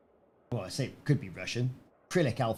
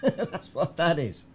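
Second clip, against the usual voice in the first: laughing; 5 semitones higher, slower than usual. Real, not acted. Laughs at own find.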